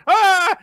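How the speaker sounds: high, loud mock scream; sudden hammed-up cry of alarm; startled yelp. Host plays Yajima caught out by the question, startled yelp.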